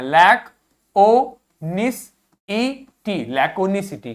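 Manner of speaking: लैक ओ निस ई टी spelled syllable by syllable; slow spelled-out pronunciation. Shown as a demonstration. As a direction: break laconicity into its sounds slowly. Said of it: "के बना है तो का बोलना है". (धीरे-धीरे अक्षर तोड़कर उच्चारण)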